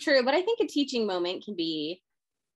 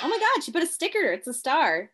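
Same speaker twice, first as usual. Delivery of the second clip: higher, quick burst of playful delight; mock excited discovery; 7 semitones higher, 3 dB louder than usual. Husband spotting a sticker in wife's planner, mock excitement. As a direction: act thrilled at a tiny reward.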